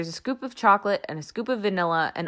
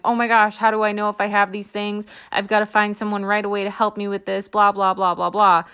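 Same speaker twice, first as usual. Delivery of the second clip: fast, louder, breathless mock panic; 2 semitones higher, 5 dB louder, faster than usual. Imitating a panicked listener who wants help right away, hammed-up fast panic. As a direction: play the frantic listener in a rush. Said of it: "freaking out going".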